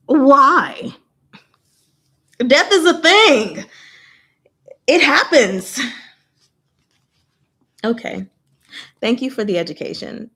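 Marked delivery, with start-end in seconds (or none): 0.1-0.9 high, loud disbelieving why; incredulous why. Real, not acted. Reading the censorship news, a loud disbelieving why. 2.5-5.8 high, loud exasperated insistence. Real, not acted. Ranting at the censors, loud and exasperated.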